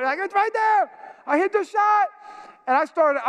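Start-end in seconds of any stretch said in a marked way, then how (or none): 0.2-2.1 high-pitched, thrilled protest; excited insisting. Retold or imitated. Golfer to doubting onlookers about the shot, excited. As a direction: insist on your triumph, pitch way up. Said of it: "I'm excited about that shot".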